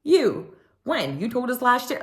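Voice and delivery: high, pointed, insistent; firm accusation. Retold or imitated. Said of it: "Sutton stood firm".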